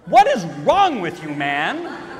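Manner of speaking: loud, laughing disbelief; incredulous outcry. Real, not acted. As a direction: call him crazy with a laugh. Exasperated at the friend.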